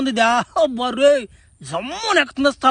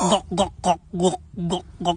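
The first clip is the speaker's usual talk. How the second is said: gulping sounds; 7 semitones lower, 6 dB quieter than usual. Acted. Drinking the potion from glass.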